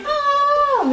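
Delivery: high startled falling yelp; a sudden high yelp as she topples. Retold or imitated. Patient slipping off the exam table, high yelp. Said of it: "I'm like".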